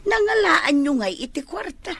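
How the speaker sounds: surprised question. Acted. Mother surprised to hear where the money came from, high startled question.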